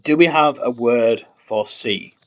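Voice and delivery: usual speaking voice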